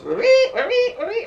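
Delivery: high, loud mimicked phone ring; imitated loud ringtone. Sue's phone rings out, loud high imitation. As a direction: squeal out a repeating ringtone. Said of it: "It's really loud".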